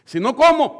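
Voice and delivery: bold prayer. Retold or imitated. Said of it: "Yo a veces así le digo".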